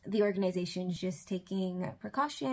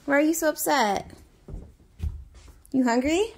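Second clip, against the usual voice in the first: concerned coaxing; 5 semitones higher than usual. Real, not acted. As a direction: call to him, worried and coaxing. Upset son won't leave car.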